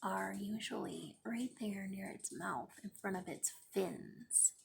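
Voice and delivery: hushed whispered aside; whispered picture aside. Real, not acted. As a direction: breathe it out like a secret. Quiet aside pointing at gills.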